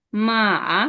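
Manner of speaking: মা dipping then rising; third tone. Shown as a demonstration. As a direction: dip মা and bring it back up. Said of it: "যেমন হচ্ছে". (মা নেমে উঠে যাবে)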